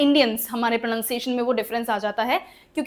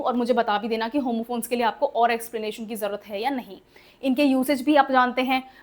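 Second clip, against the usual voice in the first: ordinary delivery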